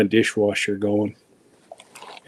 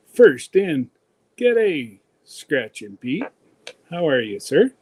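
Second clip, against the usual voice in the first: usual speaking voice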